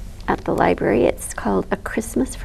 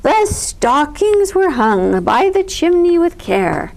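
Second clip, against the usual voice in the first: grand recitation; 6 semitones higher, slower than usual. A narrator's reading. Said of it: "Bear continued".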